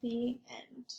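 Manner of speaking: whispered story ending. Real, not acted. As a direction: close the book in a whisper.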